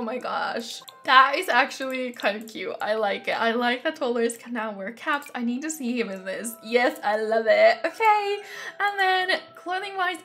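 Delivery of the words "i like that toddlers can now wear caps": ordinary delivery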